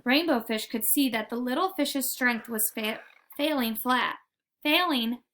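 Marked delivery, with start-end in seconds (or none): none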